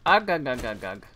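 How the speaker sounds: hammed-up cartoon crab grunts; mock cartoon crab sound effect; Mister Krabs grunts. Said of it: "I'm Mister Krabs".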